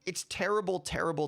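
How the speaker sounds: usual speaking voice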